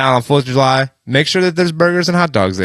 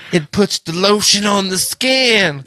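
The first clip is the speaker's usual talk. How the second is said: creepy movie quote; 6 semitones higher, slower than usual. Retold or imitated. Quoting Silence of the Lambs.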